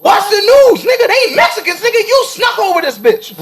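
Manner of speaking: heated rant. Real, not acted. Heated rant about news story.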